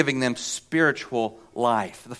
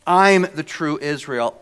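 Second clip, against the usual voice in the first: usual speaking voice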